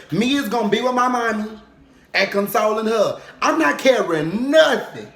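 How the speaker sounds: loud, high, outraged no; protesting auntie, high and loud; indignant refusal. Retold or imitated. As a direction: refuse the casket at full volume. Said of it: "she told me something".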